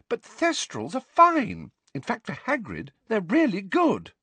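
high-pitched, indignant student venting; indignant schoolgirl. A narrator's reading. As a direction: argue heatedly for a wronged teacher.

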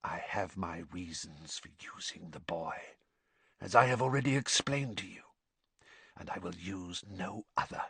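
very soft, cold, measured whisper; whispered near-hiss. A narrator's reading. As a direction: hush it right down, hissing with quiet resolve. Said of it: "whisper that was almost a hiss".

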